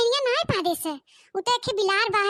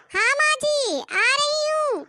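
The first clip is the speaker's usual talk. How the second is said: bride answering; 6 semitones higher, slower than usual. Acted.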